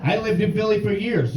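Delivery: normal talking voice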